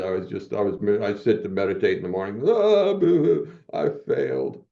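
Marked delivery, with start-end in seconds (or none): 2.5-4.6 high, slow mock sobbing boo-hoo. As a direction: ham up a whiny self-pitying cry. Mock crying over failing.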